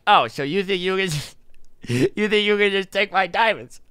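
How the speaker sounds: lightly put-on kid confronting a rival; mock-indignant accusation in an altered voice. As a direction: imitate an aggrieved young gamer. Acted angry kid confronting player.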